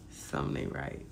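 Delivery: hushed whisper, almost no voice. Real, not acted. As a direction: breathe it out like a secret aside. Whispered aside.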